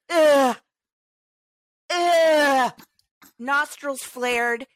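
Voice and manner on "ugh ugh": loud, pushed-out groan of disgust; a big disgusted grunt at a slow driver; disgusted ugh. Retold or imitated. Glaring at slow drivers, loud disgusted ugh. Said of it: "give you this look".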